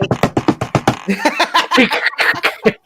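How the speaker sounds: unvoiced laughter at himself; amused breathy chuckle. Real, not acted. Laughs at own story.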